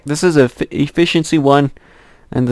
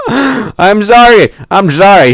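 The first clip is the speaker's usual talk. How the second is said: mock apology; 7 semitones higher, 7 dB louder, slower than usual. Pushing bunny into hole.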